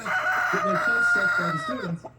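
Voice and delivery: high, loud mocking squeal at the end; mock school spokesman. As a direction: squeak it out like a whiny official. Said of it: "the way he's like".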